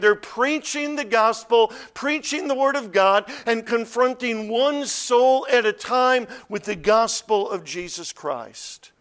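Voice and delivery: loud, high sermon delivery; full-force preaching. Real, not acted. Preacher urging the congregation on the gospel, full-force preaching. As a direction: drive it out to the whole congregation.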